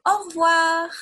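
teacher calling out au revoir for pupils to repeat; French au revoir, high and loud. Shown as a demonstration. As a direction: call out au revoir brightly for the class. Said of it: "For goodbye".

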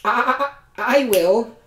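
high bleating stutter; stammering goat. Acted. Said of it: "the goat said".

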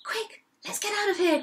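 high, hurried cry of alarm; mildly panicked storybook cry; urgent cry. A narrator's reading. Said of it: "cried the biggest billy goat".

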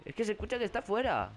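anxious at a noise outside; scared. Real, not acted. Oye al monstruo fuera. (voz nerviosa, mucho más aguda)

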